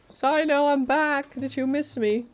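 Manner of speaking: normal talking voice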